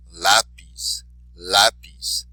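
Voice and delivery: Lápiz with a loud "Lá"; stress on accented syllable. Shown as a demonstration. Teacher modelling for repetition, Lápiz stressed on "Lá". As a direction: punch the first syllable of Lápiz. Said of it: "be sure to emphasize the accented syllable".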